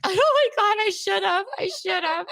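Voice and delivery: high laughing "oh my God". Real, not acted. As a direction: laugh through the words. Laughing at friend's joke.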